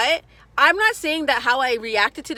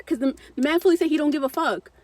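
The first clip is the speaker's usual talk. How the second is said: usual speaking voice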